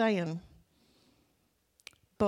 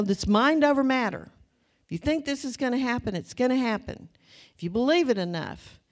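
mocking slogan; 4 semitones higher, 6 dB louder than usual. Preacher mocks mind over matter.

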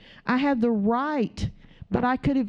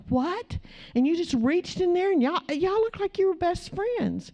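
amazed friends; 7 semitones higher than usual. Retold or imitated.

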